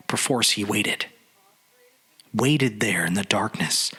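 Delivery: ordinary delivery, nothing marked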